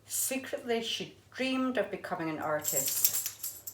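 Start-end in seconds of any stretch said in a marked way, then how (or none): none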